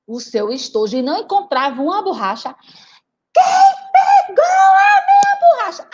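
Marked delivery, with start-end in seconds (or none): 3.3-5.9 high loud accusing yell; child shouting in class. Acted. Rita at school yelling about her eraser, high and loud. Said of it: "ela gritava".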